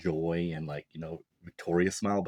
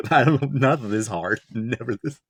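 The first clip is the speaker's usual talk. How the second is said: amused protest, higher and louder; laughing; 4 semitones higher, 7 dB louder than usual. Real, not acted. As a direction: say it through a laugh, pitched up. Jokes about having simped too.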